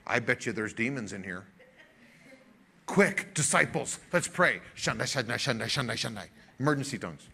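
low, hushed parody of a panicky charismatic preacher; hammed-up ghost-hunting pastor muttering fake tongues; mock frantic preacher. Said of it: "What situation did Jesus walk into and go".

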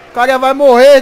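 loud, sudden alarmed call; alarmed shout. Real, not acted. Gamer shouting as soldier fires nearby, loud and alarmed. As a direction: yell out in shock.

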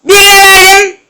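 high, stretched-out cry; high yell. Real, not acted. Gamer passes to someone, high excited yell. Blurting during a pass.

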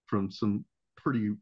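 ordinary talk

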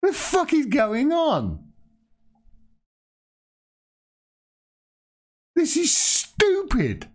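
slow, high-pitched indignant outburst; exasperated disbelief. Real, not acted. Host reacting to an absurd headline, exasperated. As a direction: stretch the words out high in exasperation.

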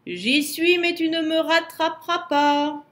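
high, loud taunt; Yeti's roaring reply. A narrator's reading. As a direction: shout back a defiant taunt from afar. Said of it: "gronda le Yéti".

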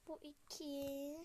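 usual speaking voice

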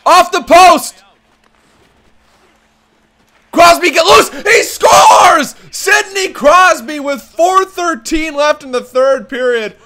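high, loud, shouted TV goal call; roaring arena announcer celebrating a goal; play-by-play call. Acted. Switches into hockey play-by-play.

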